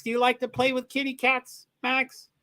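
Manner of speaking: high, playful question to a dog; sweet-talking a pup; pet-talk voice. Real, not acted. Cooing to a viewer's dog.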